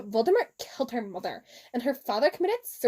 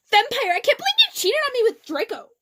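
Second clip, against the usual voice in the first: loud, high accusing yell; hammed-up shout; 11 semitones higher, 7 dB louder than usual. A narrator's reading. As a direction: belt it out like a betrayed teen. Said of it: "I shouted at him".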